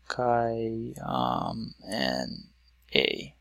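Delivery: softly drawn-out syllables, spaced apart. Shown as a demonstration. Teacher breaking up another word into syllables, syllable by syllable. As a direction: sound out each part separately, quietly. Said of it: "let's break it up into syllables again".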